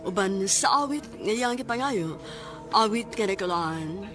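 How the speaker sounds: ordinary talk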